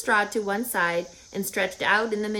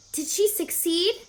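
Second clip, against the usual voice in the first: pig's voice; 9 semitones higher than usual. Acted. Wilbur eager question.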